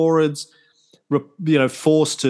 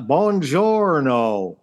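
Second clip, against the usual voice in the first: put-on hammy Italian accent, drawn out; 2 semitones higher, much slower than usual. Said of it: "And really bad Italian accent".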